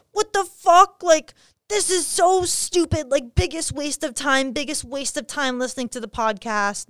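hammed-up tantrum of a rejected guy, shrieking then fading down; imitating angry guy. Guy's angry reply voiced.

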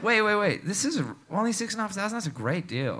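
high, eager tourist patter; lightly acted naive enthusiasm; dumb tourist. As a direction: play the clueless tourist thrilled by a bargain. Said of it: "played even further into the dumb tourist thing".

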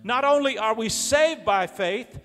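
loud, high, fervent sermon delivery; full-force preaching. Real, not acted. Preacher tells the church we are saved by faith, full-force preaching. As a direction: preach it out, loud and high, stretching key words.

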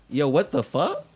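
loud, high disbelief; stunned outburst at the screen; shocked yo what the fuck. Retold or imitated. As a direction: blurt it out in shock. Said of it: "So I'm like".

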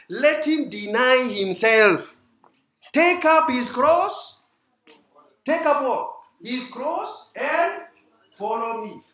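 slow, high, loud preaching. Acted. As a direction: proclaim the verse slowly and loudly. Preaching scripture at full force.

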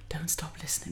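soft teasing whisper. Pretend. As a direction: whisper it back playfully. Echoing the whisper.

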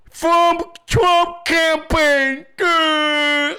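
high, very slow, loud sneering read; mock reading. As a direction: drag it out mockingly.

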